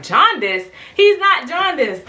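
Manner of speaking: high-pitched mock-outraged denial, laugh breaking in; indignant disbelief. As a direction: squeal the denial in comic indignation. Indignant denial of diagnosis, retold.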